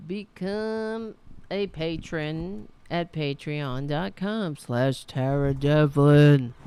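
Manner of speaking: slow, loud shouted plug; shouting into the mic. Real, not acted. Host shouting her Patreon plug at viewers, slow loud shout. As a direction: bellow the web address slowly. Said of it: "I'm shouting it into the mic".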